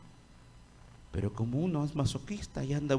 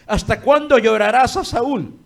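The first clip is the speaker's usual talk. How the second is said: God's voice, loud; 5 semitones higher, 10 dB louder than usual. Acted. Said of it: "dijo Jehová a Samuel".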